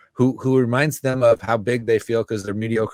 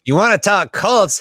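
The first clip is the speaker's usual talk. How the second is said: mock cult recruiter pitch; 8 semitones higher, 7 dB louder than usual. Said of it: "I like how this is like".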